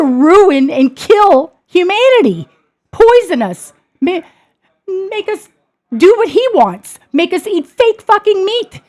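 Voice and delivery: high, loud furious tirade; outraged rant. Real, not acted. Speaker ranting to viewers about Bill Gates, furious and loud. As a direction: rail against them, real fury.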